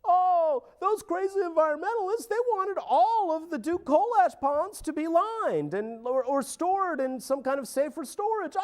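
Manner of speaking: high, sneering parody of a dismissive official; mocking the official. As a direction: mimic him high and patronizing.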